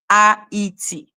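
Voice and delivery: Haiti broken into A E T, ah-ee-tee; syllable by syllable. Shown as a demonstration. Correcting Ralph on Haiti, syllable by syllable. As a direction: spell out A E T as three separate sounds. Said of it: "They say Haiti".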